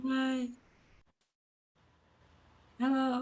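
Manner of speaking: high, soft pet greeting; talking to a pet. Real, not acted. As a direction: coo hello to the turtle.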